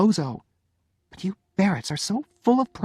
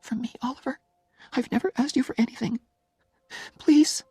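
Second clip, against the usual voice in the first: very high, tearful begging; crying quietly. A narrator's reading. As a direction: start soft, build to a desperate plea. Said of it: "Now she was crying, very quietly".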